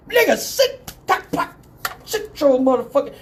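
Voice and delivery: high-pitched forceful order; retold exasperated order with sound effects; angry command. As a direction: imitate an officer commanding someone down. Cop forcefully orders man to sit.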